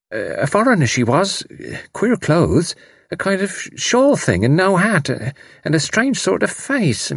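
a touch of embarrassment, feeling for the words; slightly embarrassed. A narrator's reading. Embarrassed servant describes a foreign woman, hesitantly. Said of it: "The girl seemed slightly embarrassed".

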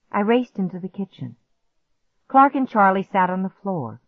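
ordinary delivery, nothing marked

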